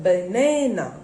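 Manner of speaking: BANANA with the middle NA loud and stretched; stress on middle NA. Shown as a demonstration. Teacher showing the stressed syllable, emphatic. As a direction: lean on the second syllable of BANANA. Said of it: "This middle na is".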